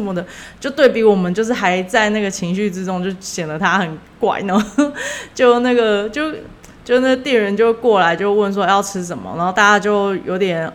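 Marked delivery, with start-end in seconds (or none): none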